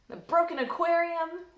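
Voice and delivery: plain reading voice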